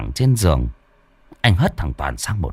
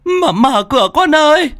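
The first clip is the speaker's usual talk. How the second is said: put-on high, loud wailing old woman at the gate; old mother wailing high for her son; old mother calling; 4 dB louder than usual. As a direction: cry out to your son through the storm, high and pleading.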